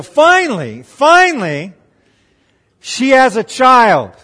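high, loud, drawn-out exclamation; triumphant relief. Real, not acted. Preacher announces Rachel's long-awaited child, loud triumphant relief. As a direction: build to a big, slow, jubilant announcement.

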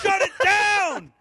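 high slow desperate shout; frantic cry. Pretend. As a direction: cry out for them to stop. Acting out the crew's panic.

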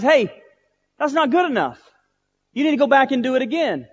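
high, loud voice of God correcting; insistent command. Retold or imitated. God tells the pastor to forgive over, insistent.

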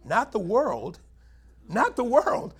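building insistence; emphatic repeat. Real, not acted. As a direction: hammer the words not the world. Preacher stressing contrast, rising.

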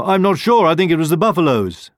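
high-pitched, hurried answer; flustered rhino. A narrator's reading. Rhino passing on the lion's question, flustered.

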